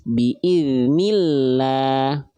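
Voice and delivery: "Biiznillah" very slow with a light, thin lam; thin lam after i. Shown as a demonstration. Teacher showing students the thin lam after kasrah, very slow. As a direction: stretch "Biiznillah" slowly, lam kept thin. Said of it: "sebelumnya kasroh".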